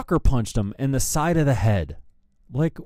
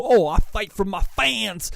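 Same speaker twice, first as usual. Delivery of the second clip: higher-pitched put-on mock boast; mocking asshole voice; 6 semitones higher than usual. Host parodying the singer's excuse about fans, mocking put-on voice. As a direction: parody his boast to make him sound stupid. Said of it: "you just sound like a stupid fucking asshole".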